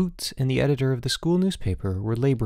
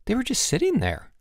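high, pleading excuse; protesting; 12 semitones higher than usual. A narrator's reading. Student explains himself to the headmaster, protesting. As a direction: offer the excuse in a much higher pitch. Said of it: "Boots protested".

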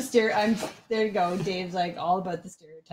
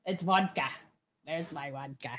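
mock Russian, sliding toward Arnold; attempted Russian accent; 3 semitones lower, 4 dB quieter than usual. Viewer requests it on awful accent night, mock Russian accent. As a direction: try a bad Russian accent. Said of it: "Do a Russian accent".

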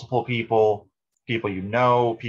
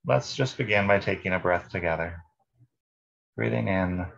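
calm meditation guide, long pauses between phrases; guided meditation. Real, not acted. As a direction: lead listeners unhurriedly through breath and body.

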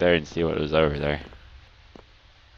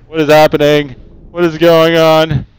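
high, loud panic; panicked yell; 8 semitones higher, 10 dB louder than usual. Real, not acted. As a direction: shout it in panic. Panic in horror game.

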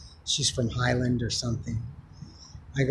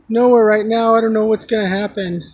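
high, loud retold lament; imitating upset woman; 7 semitones higher, 7 dB louder than usual. Woman with nowhere to live answers him, imitated upset. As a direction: play her upset answer, pitched up. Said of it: "She was crying up a storm".

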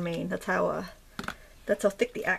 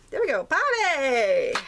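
playful cheer; 5 semitones higher, 4 dB louder, slower than usual. Pretend. Playful cheer at finishing the word.

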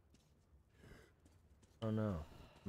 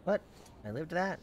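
surprised; 7 semitones higher, 5 dB louder than usual. Real, not acted. Surprised to survive.

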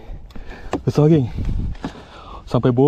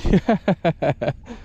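long laugh, pitched up; 4 semitones higher than usual. Real, not acted. Angler amused by another catch, laughing. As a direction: burst into laughter.

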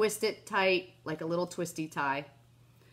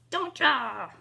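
higher, louder, sing-song; playful tease; 6 semitones higher, 4 dB louder than usual. Real, not acted. Teasing viewers who know her next step, sing-song.

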